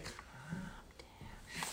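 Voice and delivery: barely audible whispered advice; hushed whisper. Retold or imitated. Reading out Angie's comment to herself, whispered. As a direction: whisper it, drawn out and very soft.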